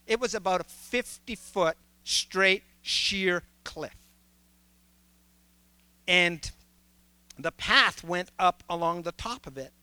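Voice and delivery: ordinary talk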